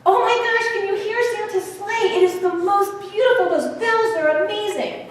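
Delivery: put-on high, thrilled children's cries; excited yelling. Children hearing Santa's sleigh bells, high excited yelling. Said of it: "they are screaming, and they are yelling".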